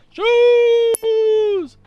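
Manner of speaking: one long, high, loud yell; screaming shoes. Acted. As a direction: cut in with a piercing, held shout. Shoes yell over the haggling.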